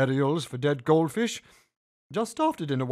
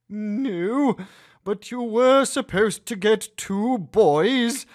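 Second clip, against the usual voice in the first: forced sweetness; 5 semitones higher, 6 dB louder, slower than usual. A narrator's reading. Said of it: "in my most loving, calm, sweet voice while gritting my teeth".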